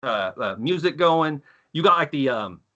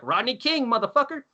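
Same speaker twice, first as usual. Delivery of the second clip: higher, mock yelled taunt at the cops; hammed-up taunt; 10 semitones higher than usual. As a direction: yell it up high to provoke the cops.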